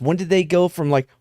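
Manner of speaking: ordinary delivery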